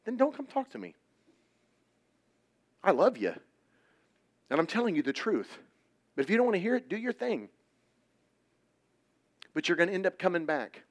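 loud, high, urgent preaching; fiery youth preacher pleading; preaching at full force. Real, not acted. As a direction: drive each line home to the crowd.

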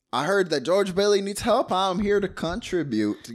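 loud, high mock announcement; hammed-up earnest neighbour; helpful townsperson. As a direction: announce your help to the room. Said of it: "He's like".

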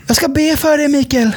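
high, sweet church-lady voice; pious church lady. Retold or imitated. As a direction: coo it like a praying church lady. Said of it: "en sån typ Jesus-tant". (hög, söt Jesus-tant-röst)

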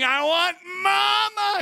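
put-on high, drawn-out toddler wail for mom; toddler meltdown scream. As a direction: scream it like a tantruming two-year-old. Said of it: "he's just screaming".

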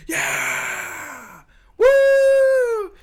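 high shrieking woo; wild cheering. Acted. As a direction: cheer like a crazed fan. GM cheering for champion.

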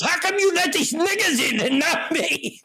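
high, put-out complaint to the hostess; indignant milkman. Retold or imitated. Turned away at the restaurant, the milkman complains, high and indignant. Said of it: "He goes".

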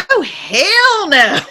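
slow, high, drawn-out comic refusal; emphatic refusal. Retold or imitated. Attendee faced with walking on fire, flatly refusing. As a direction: stretch it into a big incredulous no.

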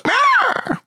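very high cartoon cat meow; squeaky meow. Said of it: "it goes".

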